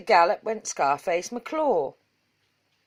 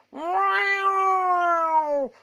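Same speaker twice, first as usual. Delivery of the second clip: high drawn-out cat meow; cat yowl; 11 semitones higher than usual. A narrator's reading. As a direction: wail it like a cat up a tree. Cat's terrible yowl in story.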